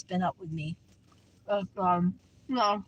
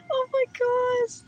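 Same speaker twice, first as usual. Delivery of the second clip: very high, loud, drawn-out gush; overjoyed squeal; 5 dB louder, slower than usual. Real, not acted. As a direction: squeal slowly, overcome by cuteness. Overcome by cute ducks.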